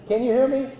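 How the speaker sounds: projecting teacher voice to the room. Shown as a demonstration. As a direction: call out to the back like a teacher. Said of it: "I'm gonna use my teacher voice rather than this".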